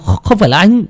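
high, stammering insistence; desperate denial. Acted. Framed man pleading innocence to Linh, desperate.

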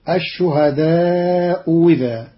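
second hamza said as "wi", "ash-shuhadaa'u widhaa"; hamza turned waw. Shown as a demonstration. Teacher shows Qalun's other reading, وذا with waw. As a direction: glide into وذا with a w plus i. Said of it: "وإبدالها واوا مكسورة".

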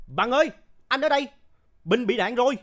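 high, urgent shout back; loud shout. A narrator's reading. Huy answers Bằng about the wounded friend, shouting. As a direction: shout for help across the distance. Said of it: "Huy hét to".

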